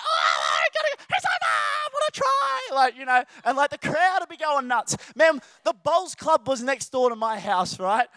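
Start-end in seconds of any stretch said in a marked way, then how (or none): 0.0-2.7 much higher shouted excited call; high commentator voice. Retold or imitated. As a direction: scream the try like a frenzied commentator. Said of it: "my high voice was like".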